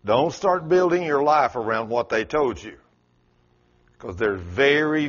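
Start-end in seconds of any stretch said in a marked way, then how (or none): none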